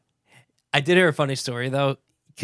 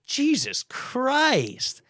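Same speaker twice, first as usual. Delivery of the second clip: high, very drawn-out "Jesus Christ"; exasperated disbelief; 11 semitones higher, much slower than usual. Retold or imitated. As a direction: stretch each word in stunned dismay. Retold shock at flight length.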